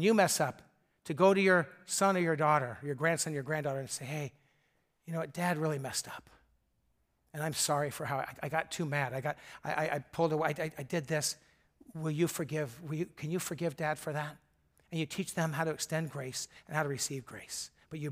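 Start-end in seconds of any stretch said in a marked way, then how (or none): 4.0-14.4 soft, low, sincere father's apology; gentle apology. Pretend. Father softly asking his child to forgive him, sincere and hushed. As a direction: quietly ask your child for forgiveness.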